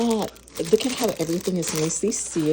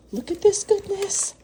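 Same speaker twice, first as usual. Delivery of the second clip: excited. Real, not acted. Thrilled at pastry.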